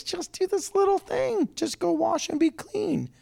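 very high, small pleading voice; gentle high-voiced reasoning; coaxing servant. Servants coaxing Naaman with little thing.

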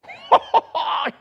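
a sudden high burst of laughter; high shocked laugh. Retold or imitated.

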